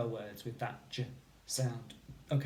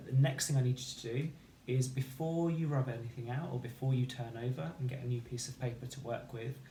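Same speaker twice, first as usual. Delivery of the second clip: ordinary delivery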